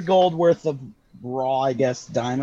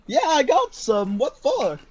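shopkeeper voice; 8 semitones higher than usual. Acted. GM voicing shopkeeper Garfield.

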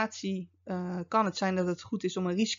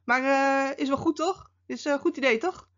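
higher, quick voice fishing for a yes; colleague seeking approval; 6 semitones higher than usual. Retold or imitated. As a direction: imitate a hopeful colleague, pitched up and hurried. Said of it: "het moment waarop ze bij je komen".

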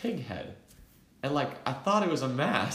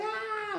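much higher mock startled cry; startled yelp; 3 dB quieter than usual. Narrator startled by friends jumping out, mock startled yelp. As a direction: yelp like you just got ambushed. Startled by the air horn prank.

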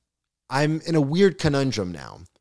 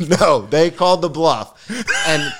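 loud, slow, laughing; laughing shout; 6 semitones higher, 6 dB louder, slower than usual. Real, not acted. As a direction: shout it while cracking up. Laughing, louder reply.

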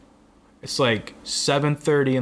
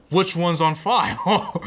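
slow, higher mock-exasperated question, breaking into laughter; 5 semitones higher, slower than usual. Customer challenges DMV clerk over address, mock exasperated. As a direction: drag it out in laughing disbelief.

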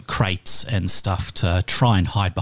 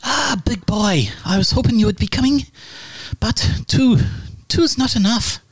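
high croaky flirt; lady's voice; 11 semitones higher than usual. Acted. GM voices the lady greeting the Dragonborn, high and croaky.

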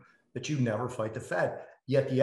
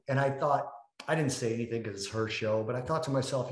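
ordinary talk

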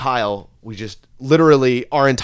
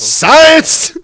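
loud, high one-word cry; sudden high comic yell; triumphant shout; 10 dB louder than usual. Acted. Comic exclamation.

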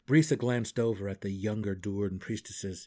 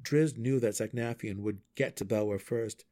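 usual speaking voice

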